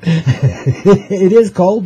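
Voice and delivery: said very slowly through laughter; laughing. Real, not acted. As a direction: laugh the words out. Joking about the Dakotas.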